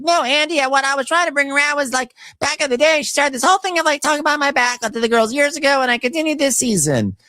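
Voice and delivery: very high whiny explaining voice; mocking Portia. Portia explaining to Andy, high whiny impression. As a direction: plead to Andy in a squeaky whine. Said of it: "Portia's like".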